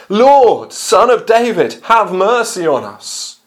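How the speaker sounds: high, loud plea called out; crying out. Acted. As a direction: cry out for mercy like blind men by the road. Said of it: "and they cry out".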